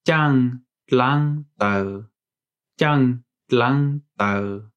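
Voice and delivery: very slow careful demo; Hmong word. Shown as a demonstration. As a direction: say "jiang lang tao" very slowly, in Hmong tones. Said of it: "we would say".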